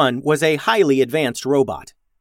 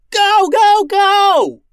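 very loud, high shout under fire; frantic yell; 9 dB louder, much slower than usual. A narrator's reading. Max yells at the crew to run under fire. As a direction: scream to hurry them. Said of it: "yelled Max".

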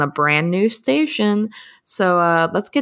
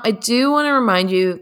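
usual speaking voice

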